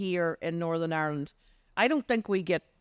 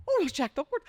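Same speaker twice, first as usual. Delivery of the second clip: starstruck excitement; 10 semitones higher, faster than usual. Retold or imitated. Starstruck seeing Jack Duckworth.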